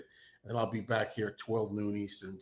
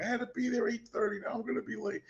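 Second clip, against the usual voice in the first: high, fretful imitation of the girlfriend; imitating girlfriend's panic; 13 semitones higher, faster than usual. Girlfriend worried about being late for work, high mock fretting. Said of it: "my girlfriend's like".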